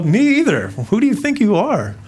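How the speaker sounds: lightly hammed-up indignation; teasing retort. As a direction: playfully call them out. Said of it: "You know".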